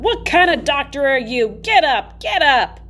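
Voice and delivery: high, loud nurse yelling; mock yell. A narrator's reading. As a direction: yell at the fainted doctor. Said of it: "yelling at him the whole time".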